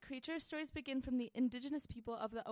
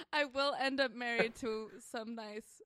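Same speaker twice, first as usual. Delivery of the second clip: ordinary talk, nothing marked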